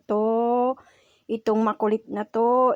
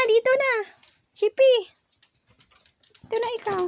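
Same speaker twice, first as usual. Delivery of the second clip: high pet call; 11 semitones higher, faster than usual. Real, not acted. Calling the dog Chippy over.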